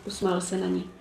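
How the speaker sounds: ordinary delivery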